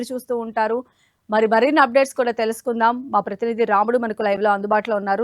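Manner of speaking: crisp studio news anchor delivery; news read. Acted. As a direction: read it like a TV bulletin. Anchor reads news bulletin.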